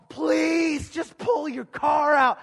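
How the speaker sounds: high, loud pleading yell at a slow car; impatient shout. Retold or imitated. Pastor retelling waiting at the mall, yelling at the car. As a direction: beg the driver to move, loudly and slowly.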